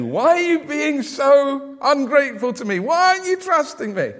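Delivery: high, loud mock outrage; hammed-up indignant complaint, pitched right up; imagined exasperated God. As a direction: demand answers as an affronted God. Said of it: "if I was God".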